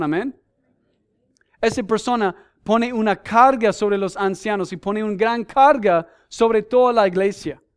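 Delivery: fervent pulpit preaching; preaching at full force. Acted. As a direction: drive each point home to the congregation.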